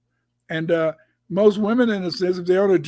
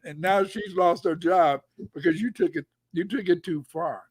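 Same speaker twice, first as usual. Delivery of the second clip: normal talking voice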